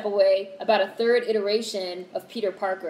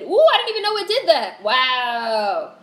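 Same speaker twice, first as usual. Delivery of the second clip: higher, louder amazed ooh; surprised delight; 7 semitones higher, 6 dB louder than usual. Real, not acted. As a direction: camera zooms in unexpectedly, she's amazed and loud. Surprised by camera zoom.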